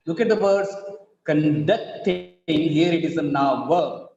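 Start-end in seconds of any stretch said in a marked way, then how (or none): 1.2-2.3 "conducting" slowly as con-DUCT-ing; weak first syllable, strong DUCT in "conducting"; second-syllable stress. Shown as a demonstration. Teacher showing the verb, stress on DUCT in "conducting". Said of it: "in the second syllable is stressed".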